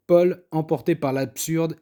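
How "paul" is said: ordinary delivery, nothing marked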